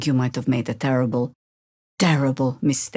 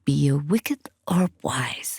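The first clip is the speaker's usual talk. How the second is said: slow unvoiced whisper; slower than usual. Ominous riddle whispered to the party. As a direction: draw it out in a hush.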